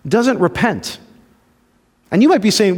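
normal talking voice

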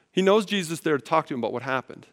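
ordinary talk

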